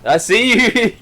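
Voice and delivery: high, loud "found you" call; playful taunt. Pretend. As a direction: call it out high, teasing a sneaker. Playful call to sneaking enemy.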